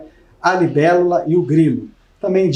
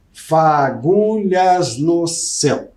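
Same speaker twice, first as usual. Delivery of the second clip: syllable counting; much slower than usual. Shown as a demonstration. Teacher scanning the last line for students, slow and syllabic. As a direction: tap out fa-gu-lhas no céu slowly. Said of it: "cinco de novo".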